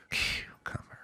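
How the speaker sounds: breathy whisper of "Cucumber"; deadpan whispered punchline. Pretend. Punchline echo of nanny.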